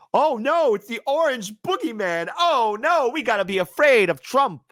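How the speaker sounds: high, hammed-up fake fright; mock panic. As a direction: sarcastically pretend to be scared. Mocking fear of Trump.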